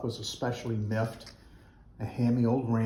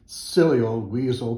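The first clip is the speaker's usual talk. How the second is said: louder, cross cry at the weasel; 7 dB louder than usual. Acted. Buffalo crying out at the weasel, loud and cross. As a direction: throw the insult out loudly. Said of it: "cried Buffalo Fluffalo".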